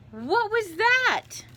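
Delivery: very high, loud startled kitten; astonished exclamation. Acted. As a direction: squeal the question slowly in amazement. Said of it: "Exclaimed Skippy John Jones".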